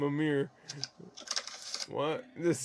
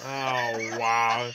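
usual speaking voice

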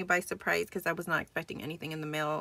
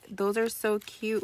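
usual speaking voice

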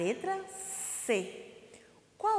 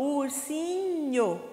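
URSINHO higher and slow, syllables stretched; 4 semitones higher, much slower than usual. Shown as a demonstration. Teacher confirming the word for pupils, URSINHO syllable by syllable. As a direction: draw out each syllable of URSINHO.

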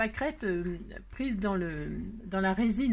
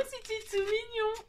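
cutesy squeal; 12 semitones higher, slower than usual. Real, not acted. Delighted by origami lily of the valley.

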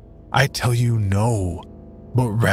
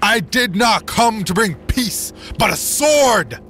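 screaming sermon; 9 semitones higher than usual. Acted. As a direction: scream it to whip up the crowd. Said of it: "screaming at the top of his lungs".

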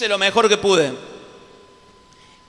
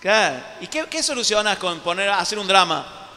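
normal talking voice

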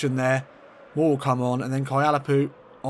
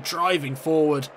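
normal talking voice

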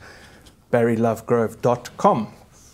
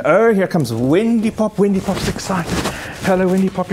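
excited pet talk; 6 semitones higher than usual. Real, not acted. Pianist greeting her dog Wendy Pop, high delighted cooing. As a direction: greet the dog like she's the best thing in the room.

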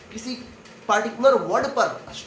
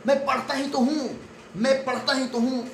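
mock angry talk-back, pitched up; angry retort; 7 semitones higher than usual. The kid answers the scolding parents back, in mock anger. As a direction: snap back at the parents, stressing पढ़ता. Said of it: "anger create होता है और आप बोलते हैं".

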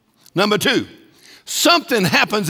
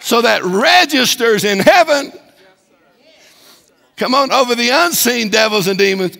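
louder, emphatic preacher's declaration; full-force preaching; 3 semitones higher, 4 dB louder than usual. Acted. Pastor telling the devil lack can't rule, emphatic preaching. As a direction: tell the devil straight, no doubt.

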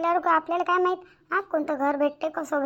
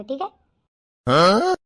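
loud, drawn-out playful rising question; teasing; 4 semitones lower, 8 dB louder, much slower than usual. Acted. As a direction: stretch it out as a cheeky dare. Teasing threat to her fiancé. (खोडकरपणे, लांबवत विचारलेलं)

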